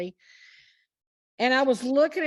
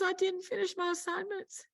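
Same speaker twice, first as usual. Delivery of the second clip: tearful woman; 9 semitones higher, 4 dB quieter than usual. Retold or imitated. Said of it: "And she goes".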